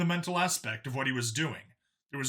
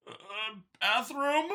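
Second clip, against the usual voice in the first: slow, high puzzled question; confused; 8 semitones higher, much slower than usual. A narrator's reading. Confused Xeno asking Vincent, high slow puzzlement. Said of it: "clearly confused".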